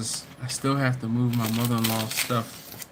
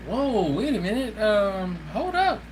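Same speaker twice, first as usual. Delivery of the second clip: surprised; 8 semitones higher than usual. Retold or imitated. Reacting to a viewer's comment.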